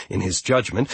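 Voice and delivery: ordinary delivery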